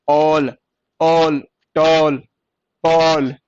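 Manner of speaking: ऑल, टॉल, पॉल with a long open vowel; stretched vowel. Shown as a demonstration. Teacher showing the vowel inside each word, drawn-out. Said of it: "इसमें sound आ रही है".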